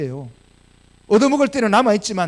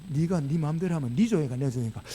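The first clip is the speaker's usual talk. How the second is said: put-on low, soft Gyeongsang-accented challenge; Gyeongsang dialect; 5 semitones lower, 7 dB quieter than usual. God correcting the self-willed preacher, in low Gyeongsang dialect. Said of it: "사투리로 말씀하시더라고요".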